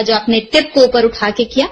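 ordinary talk

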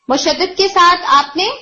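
normal talking voice

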